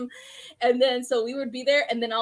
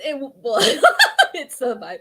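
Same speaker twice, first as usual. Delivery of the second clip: ordinary talk, nothing marked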